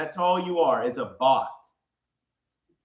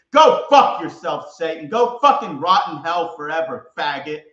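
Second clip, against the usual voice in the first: furious shout; 5 dB louder than usual. Real, not acted. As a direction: drag out the curse at full volume. Cursing out Satan.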